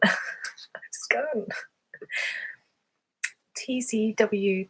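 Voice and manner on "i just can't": slow laughing whisper. Real, not acted. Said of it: "I don't know why that is".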